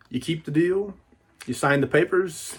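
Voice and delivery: normal talking voice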